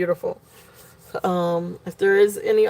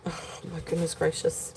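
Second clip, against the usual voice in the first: exasperated mutter; 2 semitones lower, 12 dB quieter than usual. Real, not acted. Exasperated sigh at her child.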